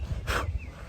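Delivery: real breathy puff of air; heavy sigh. Real, not acted. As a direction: let out a heavy breath mid-talk. Catching breath while hiking mid-talk.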